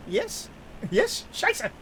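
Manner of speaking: lightly mocked German accent; a hint of a fake German accent. Streamer mimics Mike Myers' German-sounding accent. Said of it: "he did a German accent".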